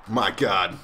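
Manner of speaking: usual speaking voice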